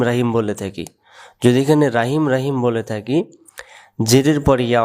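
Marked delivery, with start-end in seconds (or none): none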